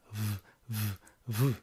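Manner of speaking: v v v buzzed to show alliteration; repeated v sound. Shown as a demonstration. Teacher to class, buzzing the v sound. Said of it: "the repetition of the sound of the consonant".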